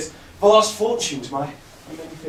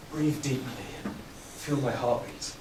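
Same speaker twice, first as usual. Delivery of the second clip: soft calm breath; 7 semitones lower, 11 dB quieter than usual. Acted. Poet son guiding himself into calm, softly. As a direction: draw it out softly like a meditation.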